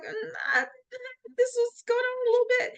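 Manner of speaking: downplay it in a tiny voice; timid understatement. Retold or imitated. Retelling hesitant confession to coworker.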